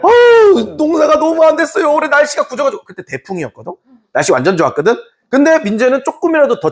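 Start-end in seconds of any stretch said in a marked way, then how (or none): 0.0-2.8 high-pitched mock lament; pleading farmer. Farmer begging the official over a bad harvest, high mock whine.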